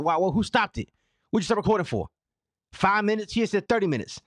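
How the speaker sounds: high, exasperated venting; irritated. Real, not acted. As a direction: rant at them, fed up. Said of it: "Now I'm irritated".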